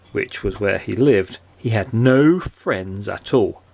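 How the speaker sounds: plain reading voice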